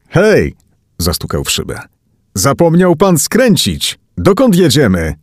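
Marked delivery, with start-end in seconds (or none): 0.1-0.5 high-pitched call for attention; a raised, urgent hey. A narrator's reading. Knocking on the taxi partition. 2.3-5.1 high, raised questioning; indignant passenger calling forward; alarmed protest. A narrator's reading. Passenger protests to silent cab driver.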